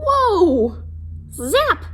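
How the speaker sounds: high, loud playful "Whoa! Zap!"; excited exclamation. Acted. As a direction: zap it out with glee. Reader sounds effect of shock.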